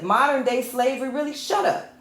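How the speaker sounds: high-pitched scornful disbelief on "modern day slavery"; incredulous. Real, not acted. Rejecting talk of modern day slavery, incredulous. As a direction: pitch it up in disbelief, then dismiss it.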